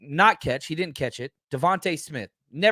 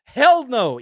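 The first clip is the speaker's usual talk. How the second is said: a louder, punchy denial; emphatic denial; 5 semitones higher, 7 dB louder than usual. Real, not acted. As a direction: reject it forcefully. Answering own rhetorical question.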